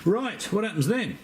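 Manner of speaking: high, mock viewer asking; voicing the viewer. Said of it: "I hear you cry".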